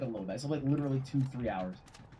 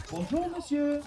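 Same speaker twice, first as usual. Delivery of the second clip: cheerful shop greeting; 9 semitones higher, 3 dB louder than usual. Retold or imitated. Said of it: "she just looks up and she goes".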